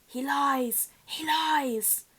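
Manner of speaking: high, loud hissing accusation from a hidden face; ghostly high voice shouting a warning; high eerie voice. Acted. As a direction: cry it out from somewhere unseen, high and urgent. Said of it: "a high voice spoke".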